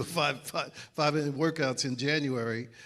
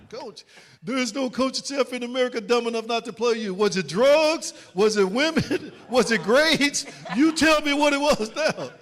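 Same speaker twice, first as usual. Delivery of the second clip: loud, much higher, hammering each question; coach interrogation; 10 semitones higher, 10 dB louder than usual. Retold or imitated. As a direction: grill him hard, half laughing at the end. Coach grilling player about drugs.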